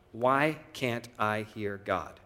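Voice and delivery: ordinary delivery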